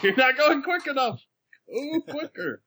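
high-pitched mock scolding from the Lord; comic high voice, urging someone on; impatient lord. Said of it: "He shouts at you".

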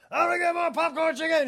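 high, loud angry yell at the kitchen; screaming Paulette. Retold or imitated. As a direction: bellow the order to the back. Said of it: "She's just screaming into the back".

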